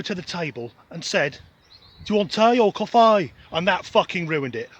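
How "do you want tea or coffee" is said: imitating a Birmingham waitress's accent; mock Brummie lilt, louder. Retelling the cafe waitress in a Birmingham accent. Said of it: "A Birmingham accent cannot be sexy".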